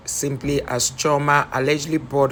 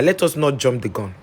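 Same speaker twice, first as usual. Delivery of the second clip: ordinary talk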